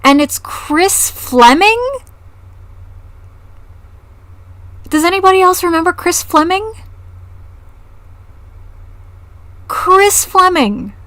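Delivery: thrilled at recognizing a name, pitch jumping up; excited disbelief. Real, not acted. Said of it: "I was so excited".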